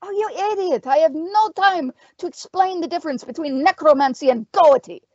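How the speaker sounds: high-pitched, impatient witch; exasperated cry. A narrator's reading. Witch to the fighter with no time to explain, crying out. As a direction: cry out at his stupidity. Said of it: "Terma cried".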